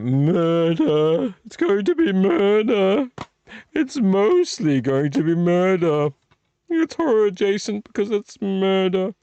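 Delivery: high, loud, drawn-out put-on voice; hammed-up "murder". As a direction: ham it up like mock melodrama. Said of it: "I see it like that".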